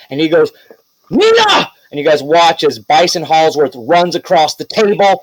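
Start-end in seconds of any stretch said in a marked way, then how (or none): 1.1-1.7 high, alarmed call; concerned cry. Acted. Bison worried for Nina, high anxious cry. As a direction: call out her name in alarm. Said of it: "very concerned for Nina himself".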